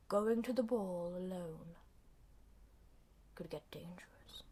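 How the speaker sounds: soft, low, slow voice fading to a whisper; hushed imitation of a lurking villain; Macavity. Macavity warns the lone cat, hushed and low. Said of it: "and Macavity goes".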